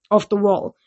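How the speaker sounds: ordinary delivery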